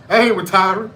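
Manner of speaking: loud mock wrestler boast; Mark Henry. Mark Henry refusing to retire, loud retold boast. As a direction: declare it to the whole arena. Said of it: "when Mark Henry was in the".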